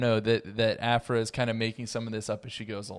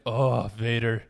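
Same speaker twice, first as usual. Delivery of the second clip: groggy morning Vader; 6 dB louder than usual. Retold or imitated. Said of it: "just being like".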